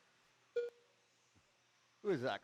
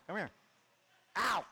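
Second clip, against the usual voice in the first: ordinary talk, nothing marked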